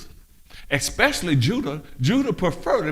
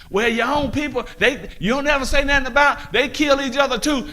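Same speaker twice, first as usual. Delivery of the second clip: mock objection; 7 semitones higher than usual. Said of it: "quoting critics".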